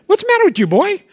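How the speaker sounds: high, pleading fatherly voice; God pleading with Cain, pitched high; God scolding Cain. Acted.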